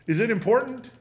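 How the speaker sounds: ordinary delivery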